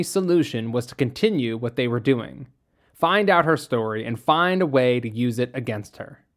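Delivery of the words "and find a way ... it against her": ordinary delivery, nothing marked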